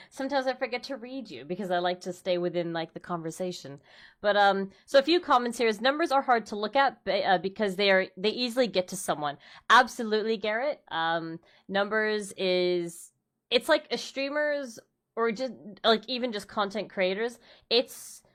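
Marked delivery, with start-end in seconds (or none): none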